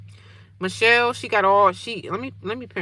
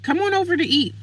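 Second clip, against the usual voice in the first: sister; 10 semitones higher, 4 dB louder than usual. Retold or imitated. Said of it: "My sister was like".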